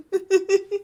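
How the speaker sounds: high squealing giggle. Real, not acted. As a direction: burst into a giddy laugh. Laughs at own gushing.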